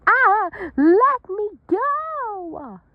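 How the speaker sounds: high, loud and slow, pulling free; loud high struggling cry. Acted. Raby Ray held by the rope cries out high and loud. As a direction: yell to be let go.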